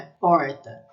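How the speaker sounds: porta with a strongly curled retroflex R; pulled Brazilian R. Shown as a demonstration. Teacher contrasts her own regional R, heavily pulled. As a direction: drag out the R of porta up in the mouth. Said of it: "bem puxado".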